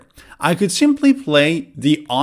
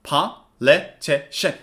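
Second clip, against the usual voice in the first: "Politician" slowly split in four; slow "Politician" with a pause after each syllable; 3 semitones lower, slower than usual. Shown as a demonstration. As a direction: say "Politician" as four even, separate beats. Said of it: "also has four syllables".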